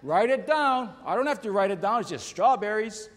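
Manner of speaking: a hint of an impatient wife answering from the kitchen; wife's reply. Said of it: "She goes".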